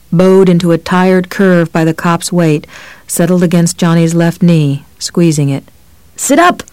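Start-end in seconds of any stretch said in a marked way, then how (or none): 6.2-6.6 yelling. A narrator's reading. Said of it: "Johnny yelled".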